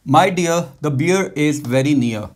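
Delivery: slow, clear classroom reading; slow example read. Shown as a demonstration. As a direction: read it out slowly for learners. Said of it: "Same ways". (धीमे साफ़ उच्चारण)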